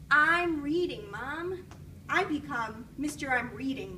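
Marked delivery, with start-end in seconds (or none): 0.1-1.7 louder, slower, fed-up reply; exasperated. Acted. Boy fed up with mom's interruptions, louder and slow. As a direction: drag it out, clearly annoyed. Hubie brushes off mom again.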